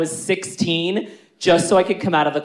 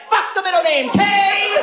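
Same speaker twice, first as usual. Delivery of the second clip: mocking shout; 13 semitones higher than usual. Real, not acted. Teasing a front-row guy about his exotic name, high mocking yell. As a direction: yell it at the crowd, laughing.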